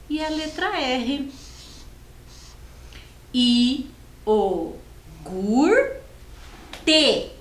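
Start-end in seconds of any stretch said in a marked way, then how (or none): 3.3-7.3 I, o, gur, te said as four separate beats; syllable by syllable. Shown as a demonstration. Teacher sounds out all four syllables of iogurte. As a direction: spell out iogurte slowly by syllables. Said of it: "É a letra T".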